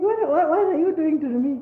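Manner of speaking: high, loud mock outrage at YouTube; indignant outburst. YouTuber retelling her shock at being demonetized, loud and high.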